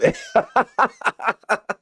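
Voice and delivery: a high, tickled laugh at the joke. Real, not acted.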